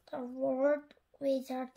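plain reading voice

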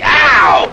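short loud yelp; sharp yelp of pain. Acted. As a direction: cry out as the baby bites. Bitten by baby.

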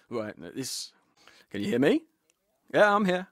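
usual speaking voice

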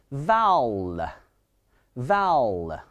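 "vowel" stretched out long and slow; stressed syllable. Shown as a demonstration. Teacher showing students the stressed first syllable, "vowel" heavily drawn out. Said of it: "它是一个重读音节".

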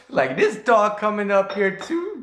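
usual speaking voice

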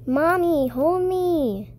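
cling and whine for mommy; little kid begging mom to hold her; clingy whine. Acted.

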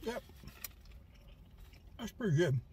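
talking with food in mouth; speaking between bites; chewing while talking. Real, not acted. Seen 5: Holds sandwich, lips closed and moving after bite; likely chewing.

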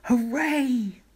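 very high cheering hooray; villagers cheering. A narrator's reading. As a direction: cheer out to the crowd. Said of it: "villagers shouting".

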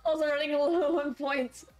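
high squealing mock fright; hammed-up scared shrieks. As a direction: play up the jump scare, squeaking. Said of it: "I'm so scared".

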